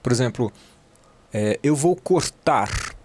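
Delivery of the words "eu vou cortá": "cortar" devagar, sem o R no fim; R final omitido. Shown as a demonstration. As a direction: say "cortar" slowly as "cortá", stressed last vowel. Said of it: "Você não pronuncia o R no final". ("cortar" arrastado, dito "cortá")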